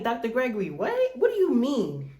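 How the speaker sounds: outraged at Doctor Gregory's words, high and loud; indignant disbelief. Retold or imitated.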